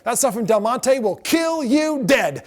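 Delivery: slow sing-song spiel line; sing-song warning. Retold or imitated. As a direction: chant it like a ride announcer's joke. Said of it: "I said".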